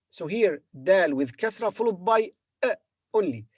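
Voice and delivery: ordinary talk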